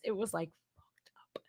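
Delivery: hushed unvoiced whisper. Real, not acted. Whispering a secret to the viewers about the binge. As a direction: lean in and whisper it like gossip.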